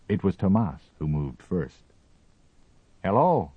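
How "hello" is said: called out loudly; calling out. A narrator's reading. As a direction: call a greeting across the distance. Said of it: "he called".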